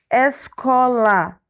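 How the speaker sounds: very slow, each syllable apart. Shown as a demonstration. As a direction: sound it out in separate chunks. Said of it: "O S ficou na primeira sílaba". (bem devagar, sílaba por sílaba)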